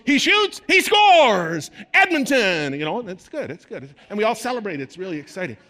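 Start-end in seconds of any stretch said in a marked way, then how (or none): 0.1-2.7 ecstatic shouted play-by-play, much higher and louder; hockey commentator call. Retold or imitated. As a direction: yell the goal like a live broadcaster. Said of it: "the commentator goes".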